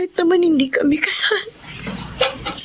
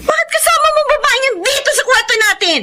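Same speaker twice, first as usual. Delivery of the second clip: shocked accusation; 8 semitones higher than usual. Acted. Wife catches husband with mistress.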